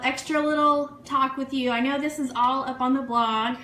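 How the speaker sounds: normal talking voice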